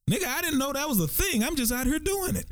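high, squeaky mock bewilderment; baffled protest. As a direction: plead cluelessness in a comically high voice. Acted reply to being asked questions.

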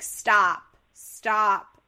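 loud, urgent repeated stops. Retold or imitated. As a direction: break up the fight loudly. Janet and Eddie intervene.

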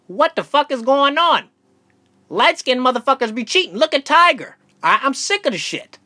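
high, loud comic rant; mock outrage. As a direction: rant it up like you're fed up. Comic rant about light-skinned cheaters.

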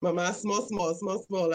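playful Krio reply; casual Sierra Leone Krio; sierra leone creole. Real, not acted. Guest answers in Krio.

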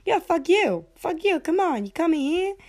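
high-pitched mock fury; hammed-up angry movie wife picking a fight; angry gangster wife. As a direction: act out a feisty, high-voiced telling-off. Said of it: "they're just angry".

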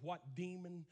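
usual speaking voice

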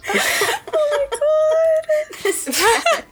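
high, slow words broken by laughter; laugh-crying. Real, not acted. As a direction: gasp it out through laughter. Laughing hard at the chaos.

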